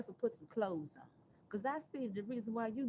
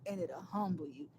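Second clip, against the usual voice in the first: quoting her advice; 4 semitones lower than usual. Retold or imitated. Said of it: "That what she said to me".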